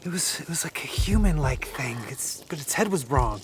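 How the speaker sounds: hushed, breathless whisper; frightened whisper. Acted. Zeb tells his friends what he saw, frightened whisper. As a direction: whisper it as if the thing might hear.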